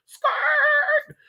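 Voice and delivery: high shouted skirt like screeching tires. Retold or imitated. Telling friends how she sped off, screeching tire sound. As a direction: belt it out like tires peeling off. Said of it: "I think my tires said".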